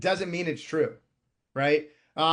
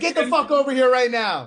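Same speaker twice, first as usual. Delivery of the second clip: put-on higher, louder barked order to the kid; mock yell at kid; 8 semitones higher, 7 dB louder than usual. Dad yelling at his kid to get over here, loud mock shout. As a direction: call the kid over with a loud fake roar. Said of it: "I swear at my kid. I'm like".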